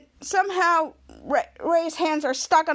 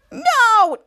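loud, high drawn-out shout; hammed-up desperate cry; retold frantic yell; 6 semitones higher, 8 dB louder than usual. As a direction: yell the refusal in mock despair. Acting out Ray's frantic answer.

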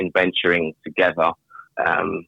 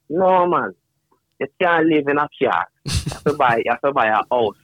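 higher, lightly imitated Jamaican grandfather; Jamaican accent; 6 semitones higher than usual. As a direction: play a Jamaican granddad giving advice. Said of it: "in his thick Jamaican accent".

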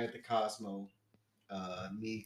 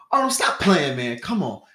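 loud, raised-pitch urging; imitated insistence; 3 semitones higher, 11 dB louder than usual. Stranger sure that he knows Arnold, loud insistence. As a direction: mimic a buddy who won't believe it.

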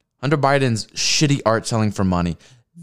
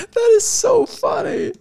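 high squealing laugh through words; laughing hard. Real, not acted. Laughing hard at the crying clip. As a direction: crack up at it.

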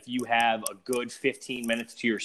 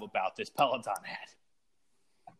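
whispered; 2 semitones lower, 8 dB quieter, slower than usual. Real, not acted. Whispered aside about the ad.

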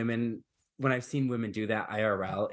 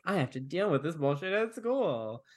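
put-on whiny teen complaint; 6 semitones higher than usual. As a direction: whine it like a sulky teenager. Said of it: "I'm giving her a whiny voice".

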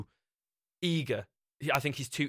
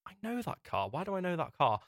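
higher-pitched, retold puzzlement; puzzled recognition; 7 semitones higher than usual. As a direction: wonder aloud as the memory nags. Retells puzzling over a photo.

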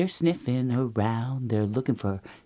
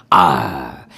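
very low, loud growl; bear growl; 12 semitones lower, 9 dB louder than usual. Acted. Papa Bear sets off searching, low growl.

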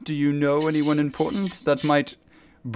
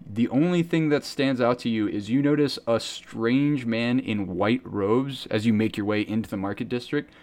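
usual speaking voice

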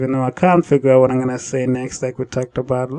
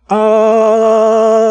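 high, loud wobbling vibrato; long sung note with vibrato; vibrato effect; 6 semitones higher, 4 dB louder than usual. Shown as a demonstration. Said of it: "I can add a vibrato".

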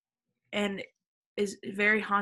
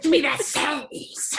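slow, loud, higher hammed-up grab; mock greedy demand; 6 semitones higher, 6 dB louder, slower than usual. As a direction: demand it like she's starving for it. Girl grabbing the holy water.

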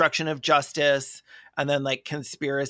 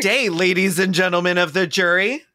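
mock closing argument; 7 semitones higher, 4 dB louder than usual. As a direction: play a blustering defendant before the jury. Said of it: "would be like".

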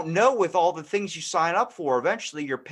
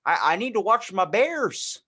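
clueless dad; 3 semitones higher than usual. Acted. Clueless dad calling his internet provider, folksy voice. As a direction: play a cheerful clueless dad. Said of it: "that dad that doesn't actually know".

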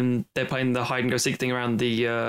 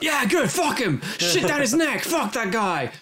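gleeful yell; 10 semitones higher, slower than usual. Retold or imitated. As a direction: cheer the kill like a frat bro. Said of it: "the death of the cave troll would be like".

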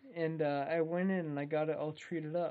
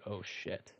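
hushed dread; 6 semitones lower, 11 dB quieter than usual. Retold or imitated. Everyone freezes at the man.

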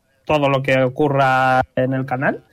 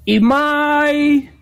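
grand announcer, rising higher at the name; omnipotent voice; 5 semitones higher than usual. Acted. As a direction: boom it out like a voice from above. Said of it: "se escucha una voz omnipotente".

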